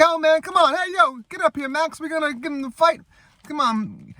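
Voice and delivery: high, squealed ringside challenge; hammed-up movie fighter calling out an opponent; mock trash talk. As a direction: goad them in a put-on high comic voice. Retold trash talk in boxing ring.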